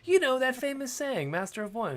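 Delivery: high, animated sing-song setup; mock explaining. Host spoofing a chat about a famous saying, mock explaining in a high voice.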